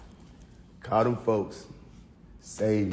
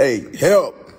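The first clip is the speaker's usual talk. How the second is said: hammed-up cry for help, a bit louder; playful mock plea; mock call for help; 3 semitones higher, 4 dB louder than usual. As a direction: fake calling for rescue.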